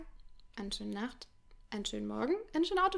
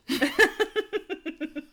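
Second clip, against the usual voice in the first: hearty laugh; 6 semitones higher, 5 dB louder than usual. Real, not acted. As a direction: burst of high laughter. Big laugh after joke.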